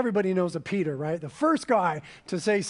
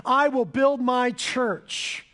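proclaimed; 4 semitones higher, much slower than usual. Acted. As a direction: declare "I will build my church" like a promise from the pulpit. Jesus' promise proclaimed aloud.

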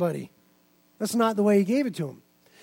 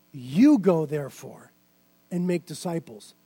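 Jesus commanding; 7 semitones lower than usual. Pretend. The risen Lord commissions his disciples, low and calm. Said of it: "And he says to them".

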